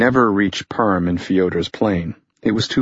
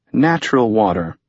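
usual speaking voice

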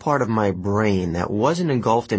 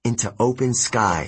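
ordinary delivery, nothing marked

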